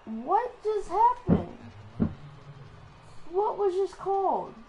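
loud, high-pitched complaint; indignant protest. Real, not acted. Player objecting to an untimely timeout, indignant protest.

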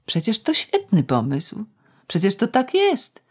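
high eager insistence; rising eager protest. Acted. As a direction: insist brightly. Girl's eager inner defence.

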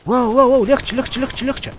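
loud high excited shouts; excited yelling. Real, not acted. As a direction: yell it out mid-fight, thrilled. Excited gaming outburst.

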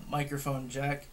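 ordinary talk, nothing marked

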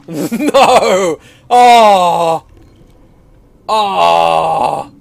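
loud, drawn-out cry of dismay; long, loud groaning howls; anguished wail. Real, not acted. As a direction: wail in horror at what's ahead. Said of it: "Those are not sounds of pleasure".

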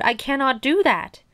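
high, insistent storybook protest; shouting. A narrator's reading. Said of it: "shouted Toad".

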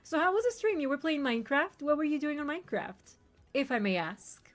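ordinary delivery

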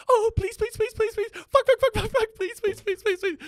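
very high, loud mock moaning pleas; imitating loud moaning. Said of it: "And she goes".

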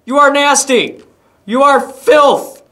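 high-pitched revolted exclamations; loud disgust. Real, not acted. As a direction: shriek your disgust at the creatures. Disgusted by game creatures.

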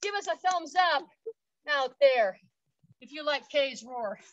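breathless after exercise; out of breath. Real, not acted. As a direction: talk while catching breath. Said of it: "We're a little out of breath".

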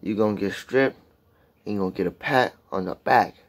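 lyric restated in separate, deliberate phrases; measured, careful recital with pauses; careful restatement. Shown as a demonstration. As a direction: spell it out phrase by phrase, pausing between. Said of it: "Sound like I'm stuttering and shit".

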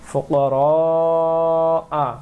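slow melodic tajweed chanting; slow chanted Quranic recitation. Shown as a demonstration. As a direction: draw the vowels out in a sung recitation. Recites verse demo.

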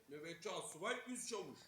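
ordinary delivery

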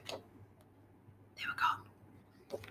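whispered, very slow vanishing line; soft breath of wonder; hushed ending. A narrator's reading. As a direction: let it fade to a slow whisper.

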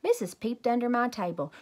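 ordinary delivery, nothing marked

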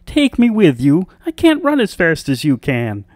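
high, loud frog plea; crying out. A narrator's reading. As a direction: call after her as a high-voiced frog. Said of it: "cried the frog".

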